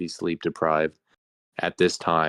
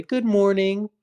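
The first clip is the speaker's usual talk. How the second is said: high, gentle morning show host; soft anchorman greeting; 13 semitones higher, 4 dB louder than usual. Shown as a demonstration. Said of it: "a soft voice to say".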